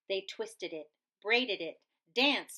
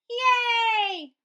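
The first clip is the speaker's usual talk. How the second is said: high, loud cheer; 14 semitones higher, 10 dB louder than usual. Acted. Crowd cheering the bully gone, high and loud. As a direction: cheer with joy.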